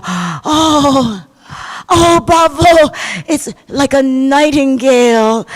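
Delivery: mock cheering. Children cheer the singing.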